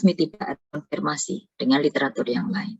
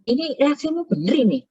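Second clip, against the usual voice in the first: high-pitched retold challenge to a student; questioning lecturer; 10 semitones higher than usual. Lecturer asks student if the reaction is right, questioning. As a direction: query it with raised pitch, acting out the lecturer. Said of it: "Terus saya tanya".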